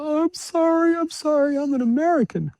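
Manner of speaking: high, frantic apologetic shriek; panicked scream. Retold or imitated. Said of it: "so she screamed out".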